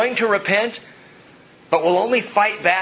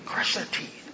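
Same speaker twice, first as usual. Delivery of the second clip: hushed, voiceless vengeful prayer; soft, unvoiced mock menace; whispered relish; 9 dB quieter than usual. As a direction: whisper it as a secret curse.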